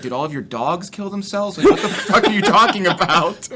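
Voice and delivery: yelling at the heckler in a bar, laughing; retold comeback yell. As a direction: fire it back at the heckler, cracking up. Shouting back at heckler.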